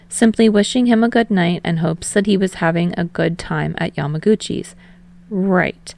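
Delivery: ordinary delivery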